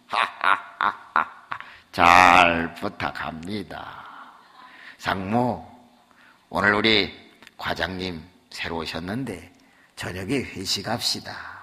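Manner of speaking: low, slow booming laugh and welcome; hearty chairman. Retold or imitated. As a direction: play the big boss, pleased. (회장님처럼 낮고 천천히 호탕하게)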